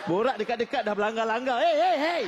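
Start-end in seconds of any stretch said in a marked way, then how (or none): 1.6-2.2 high, loud repeated hey; mock hailing. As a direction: call out, rising and louder. Acting people bumping into each other.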